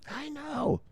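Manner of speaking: tiny, very high falsetto; mock squeal. Streamer conceding it sounds bad, mock falsetto.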